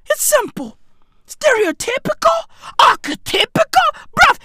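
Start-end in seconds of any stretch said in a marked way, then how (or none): none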